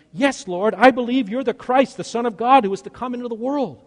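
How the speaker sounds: high, loud heartfelt exclamation; Martha voice. Retold or imitated. Child as Martha answering Jesus in a high, fervent voice. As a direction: proclaim it high with all your conviction. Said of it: "in my Martha voice".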